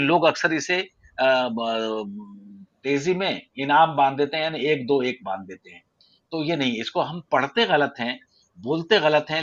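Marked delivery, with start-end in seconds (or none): none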